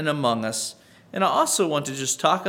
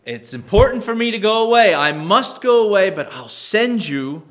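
loud, raised-pitch insistence; emphatic declaration; 6 semitones higher, 8 dB louder than usual. Acted. Preacher quoting Jesus to the disciples, emphatic declaration. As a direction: proclaim it forcefully, well above normal pitch.

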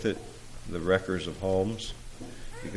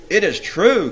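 higher, louder insistence; countering; 11 semitones higher, 12 dB louder than usual. A narrator's reading. Jealousy contradicts the demon prince, insistent retort. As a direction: insist against a doubter. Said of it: "countered Jealousy".

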